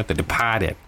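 Boston accent. Shown as a demonstration. Host echoes the film title in a Boston accent. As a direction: say Departed with a Boston accent, dropping the R. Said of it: "That's from Boston accent".

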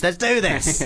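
rally them as a joke; mock rallying cry. Mock heroic punchline of acted quote.

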